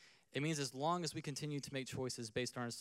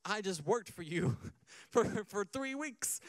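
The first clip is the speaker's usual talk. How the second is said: louder, much higher mock outrage; indignant disbelief; 6 semitones higher, 4 dB louder than usual. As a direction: retell the shock at the fee, half-laughing. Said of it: "I was like".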